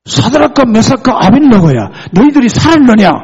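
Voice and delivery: loud, high call of amazement; high, shouted royal astonishment; startled king. Retold or imitated. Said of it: "이 왕이 놀래가지고".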